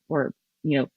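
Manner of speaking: usual speaking voice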